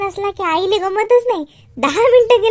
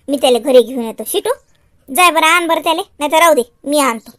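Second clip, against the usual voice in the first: low, grumbling motherly voice; Shyam's mother; 6 semitones lower than usual. Acted. Mother wants Shyam brought home, low grumbling voice. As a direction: fuss like a mother, pitched well down.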